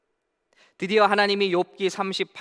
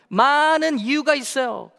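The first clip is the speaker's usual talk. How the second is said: mocking devil voice; 8 semitones higher, 7 dB louder, slower than usual. 마귀 목소리로 흉내.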